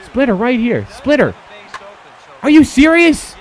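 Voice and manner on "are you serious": much higher, louder incredulous cry; fed-up shout at the pitcher; exasperated disbelief. Real, not acted. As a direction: demand it loudly in disbelief.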